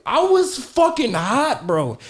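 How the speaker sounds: high, loud, incredulous outburst; worked-up indignation. Real, not acted. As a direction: relive the outrage, half laughing. Indignant retelling of the insult.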